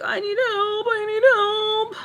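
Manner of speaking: very high, squeaky plea; exaggerated high pleading, drawn out at the end; mock whine. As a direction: whine helplessly for help. Whines to husband in retold exchange.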